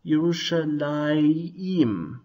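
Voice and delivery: "Yerushalayim" very slow, a clear yim at the end; Hebrew pronunciation. Shown as a demonstration. Teacher points out the hirek in the name, drawn-out Hebrew pronunciation. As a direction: draw out "Yerushalayim" so the final i is heard. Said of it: "and pronounced".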